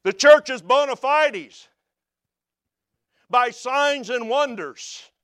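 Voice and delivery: rising preacher's cadence, driving each promise home; preaching at full force. Acted. Preacher proclaiming the signs to believers, building and fervent. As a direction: proclaim it to the congregation like a revival.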